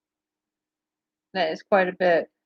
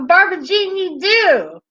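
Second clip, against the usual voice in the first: mock teasing; 10 semitones higher, 3 dB louder than usual. Real, not acted. Host teasing Barbara Jean about her accent, high and playful. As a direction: tease loudly in a high, swooping voice.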